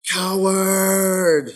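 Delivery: loud, high furious cry of coward; angry shout. Acted. Shiloh venting his anger at the world, furious shout. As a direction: hurl it out at full force. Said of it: "shouted at the top of his lungs".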